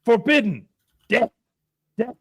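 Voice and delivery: high, halting pidgin decree; hammed-up foreigner's grave prohibition; mock stern warning. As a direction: bark single words as if short on vocabulary. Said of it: "And he's like".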